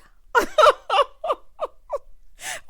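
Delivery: loud, high cackling laugh; big laugh. Real, not acted. Recapper cracking up at Maurice's plea, loud laugh. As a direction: burst out in high, helpless laughter.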